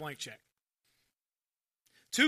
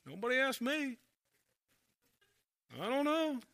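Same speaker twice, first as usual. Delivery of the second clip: higher-pitched mock grumbler; nitpicky questioning; 4 semitones higher than usual. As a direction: play a put-out church member questioning everything. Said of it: "Just nitpicky questioning all the time".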